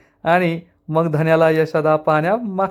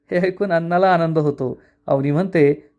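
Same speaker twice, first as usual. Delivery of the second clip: ordinary delivery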